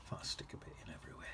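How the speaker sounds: hushed, quick whisper; real whisper. Real, not acted. Painter absent-mindedly whispering while adding bits of paint, whisper. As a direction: slip into a quick, soft whisper. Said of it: "I don't know why I'm whispering".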